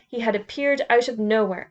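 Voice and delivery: ordinary delivery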